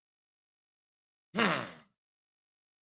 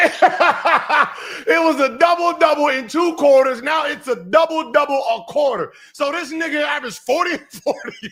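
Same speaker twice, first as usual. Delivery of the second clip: laughing, loud and higher mock shout; laughing mockery; 6 semitones higher, 5 dB louder than usual. Real, not acted. Host mocking the guest's story, laughing loud. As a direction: laugh while you call out the exaggeration. Mocking the growing stat claim.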